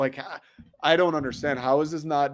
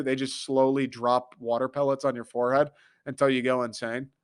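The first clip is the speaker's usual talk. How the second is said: ordinary delivery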